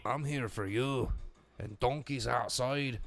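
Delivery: slow, badly faked ogre accent; half-successful cartoon ogre voice; Shrek. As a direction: draw it out in a rough ogre impression. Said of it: "I can't do a Shrek impression".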